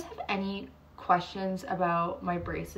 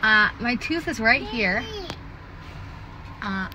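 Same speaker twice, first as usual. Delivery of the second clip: pulling lip down with finger; finger in mouth; 5 semitones higher, 6 dB louder than usual. Real, not acted. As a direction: mouth held open by finger. Seen 0: Finger at lips, then inside the mouth pulling the lower lip down.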